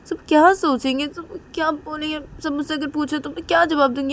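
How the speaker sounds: put-on upset young woman. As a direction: beg mom to understand her fear. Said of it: "Reshma बोलती है".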